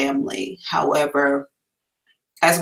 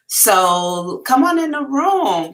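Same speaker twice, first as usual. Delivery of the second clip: sing-song welcome; 9 semitones higher, slower than usual. Acted. High drawn-out welcome call.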